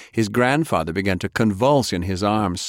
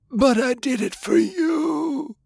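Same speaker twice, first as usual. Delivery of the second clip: slow, very high crying plea; tearful wail; slower than usual. A narrator's reading. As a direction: wail it desperately to your grandson. Dying grandfather's tearful last plea.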